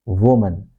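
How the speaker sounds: "Woman" as WUU-mun; weak schwa closing "Woman"; schwa ending. Shown as a demonstration. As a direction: stress the first syllable of "Woman", reduce the second. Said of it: "It is woman".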